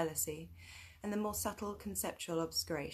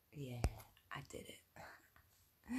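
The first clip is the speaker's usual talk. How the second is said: whispering; 6 semitones lower, 8 dB quieter, much slower than usual. Real, not acted. Quiet aside about phone.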